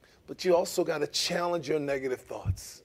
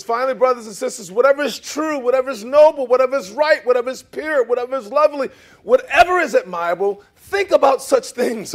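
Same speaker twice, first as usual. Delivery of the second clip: loud, high preaching cadence; reading Philippians to the church, preaching; preaching scripture; 6 semitones higher, 8 dB louder than usual. Acted. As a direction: proclaim each line with rising force.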